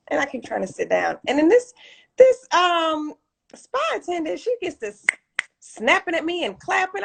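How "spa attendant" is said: normal talking voice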